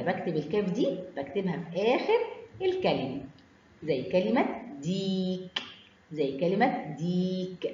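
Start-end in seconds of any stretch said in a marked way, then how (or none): 4.8-5.6 ديك with a long drawn-out "ee"; slow ديك, vowel held long. Shown as a demonstration. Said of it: "مد بالياء". 7.0-7.7 ديك with a long drawn-out "ee"; slow ديك, vowel held long. Shown as a demonstration. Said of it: "مد بالياء".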